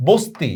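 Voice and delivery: বস্তি with a thin "s" before t; dental s. Shown as a demonstration. Teacher contrasts it for students, বস্তি with dental "s". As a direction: say বস্তি as "bosti", no "sh". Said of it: "দন্ত স্বর মতো করতেছি".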